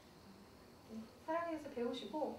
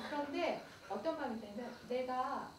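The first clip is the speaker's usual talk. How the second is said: ordinary talk, nothing marked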